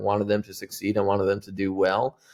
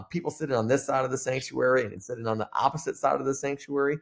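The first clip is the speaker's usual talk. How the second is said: ordinary talk, nothing marked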